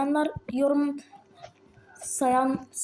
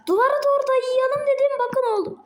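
usual speaking voice

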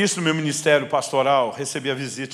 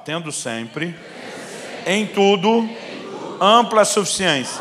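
louder and higher, like someone well fed and strong; with full energy; 6 semitones higher, 6 dB louder, slower than usual. Shown as a demonstration. Pastor leads congregation in a repeat, full of energy. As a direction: say it again with real gusto, lifting em tudo. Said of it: "como se você estivesse jantado".

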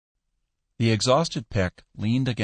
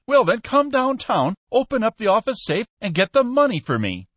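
much higher and louder, pushing hard; frantic Peck; 13 semitones higher, 4 dB louder than usual. A narrator's reading. Peck pressures Skinner to open the safe, frantic and loud. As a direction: insist in rising desperation.

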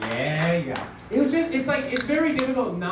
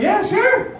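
put-on girlfriend voice; 7 dB louder than usual. Girlfriend agreeing, acted voice.

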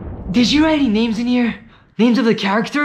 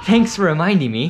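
normal talking voice

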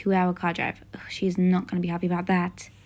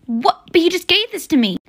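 higher, louder whiny objection; teen whining back at mom; indignant protest; 7 semitones higher, 5 dB louder than usual. Acted. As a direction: protest the unfairness. Daughter protests mom's ultimatum.

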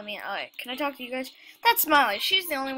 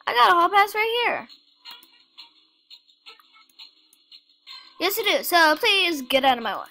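loud, firm, higher insistence; insistent loud retort; 6 semitones higher, 7 dB louder than usual. Acted. Player arguing with the hall guard, loud and insistent. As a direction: push past the guard, raised voice.

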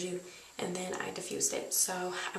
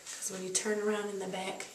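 ordinary talk

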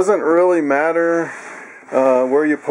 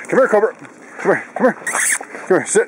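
higher, calling out to the dog; 5 semitones higher, much faster than usual. Real, not acted. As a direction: call him over and tell him to sit.

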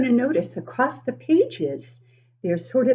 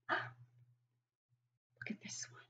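whisper; 23 dB quieter, much slower than usual. Real, not acted. Teasing the next page to kids.

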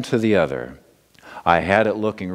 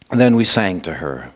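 ordinary delivery

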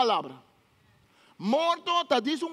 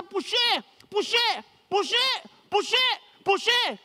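higher, rising strained cries of push; straining push; 6 semitones higher, much slower than usual. Acted. Pastor acting out a mother in labor, high strained pushes.

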